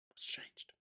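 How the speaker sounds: breathy whisper of the question; soft bitter whisper; whispered aside. Real, not acted. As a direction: ask it under your breath. Rhetorical repeat, whispered.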